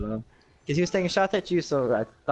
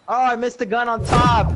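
frustrated yelp; 9 semitones higher, 7 dB louder, slower than usual. Real, not acted. Missing loot while playing.

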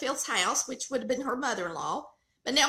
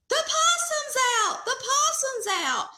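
high frantic yell of alarm; retold yelling; 12 semitones higher, 4 dB louder than usual. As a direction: yell it like the possum's loose. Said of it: "Barry starts yelling".

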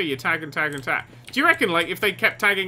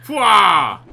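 loud, drawn-out martial-arts "fwah"; kick yell; 2 semitones higher, 9 dB louder than usual. Real, not acted. Player attempting a flying leap kick, loud fighting yell. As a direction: shout a kick noise.

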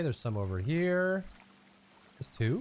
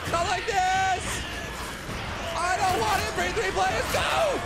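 panicked shouting. Real, not acted. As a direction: scream in panic as you go down. Overwhelmed, shouting at enemies.

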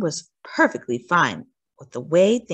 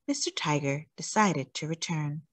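ordinary delivery

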